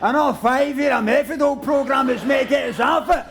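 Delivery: pitched well above normal speech. Acted. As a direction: shout it out like an aggrieved ranter. Performs a catchphrase for the audience.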